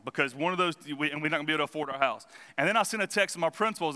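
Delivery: usual speaking voice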